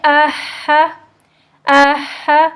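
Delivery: आह begun with a sharp glottal catch, then breath; hamza with ha. Shown as a demonstration. Teacher drilling students on hamza, glottal catch. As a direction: catch the throat, then release आह. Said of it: "हमज़ा के साथ इसको आपको practice".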